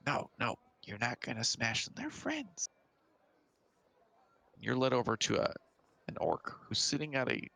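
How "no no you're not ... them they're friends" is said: put-on a kid gently scolding an ogre; little kid. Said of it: "And the little kid goes".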